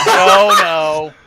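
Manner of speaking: laughing no. Real, not acted. Laughing at own joke.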